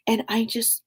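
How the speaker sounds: normal talking voice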